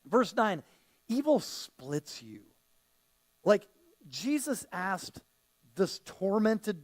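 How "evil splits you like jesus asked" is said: normal talking voice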